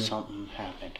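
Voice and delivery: low, very soft murmur; hushed unease. Real, not acted. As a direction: murmur it while listening out. Uneasy hush while listening in dark.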